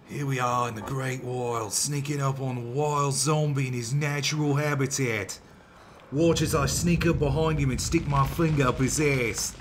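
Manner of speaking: put-on amateur Australian wildlife-host accent; bad Australian accent. Gamer narrating sneaking up on a zombie, mock Australian accent. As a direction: narrate like an Australian nature presenter, badly. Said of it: "That's the best Australian accent I can possibly do".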